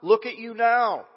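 loud, high jeering cry; mocking shout. Passersby jeering at the crucified Jesus, loud high mocking shout. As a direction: jeer at him from the crowd. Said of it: "shouted abuse, mocking".

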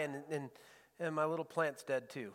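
low, soft little sulk; mumbled pout, lightly acted; pouting prophet. Preacher mimics a pouting prophet's complaint, mild low sulk. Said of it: "we see a pouting prophet".

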